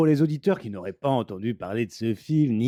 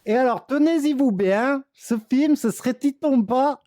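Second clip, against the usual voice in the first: higher, pompous hype for a flop; mock-grandiose announcement; 9 semitones higher than usual. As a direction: oversell it like a trailer voice-over. Ironic grand film announcement. (annonce pompeuse et ironique)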